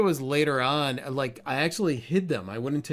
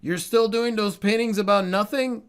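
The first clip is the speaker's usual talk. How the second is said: put-on Cuban accent; 8 semitones higher, 5 dB louder than usual. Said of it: "he would say in his thick Cuban accent".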